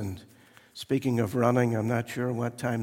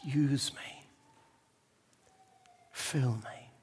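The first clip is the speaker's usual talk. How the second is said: softer whispered plea; whispered prayer; 7 dB quieter, slower than usual. Pretend. Believer prays to the Lord in a whisper. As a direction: breathe the prayer to God. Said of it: "and to say".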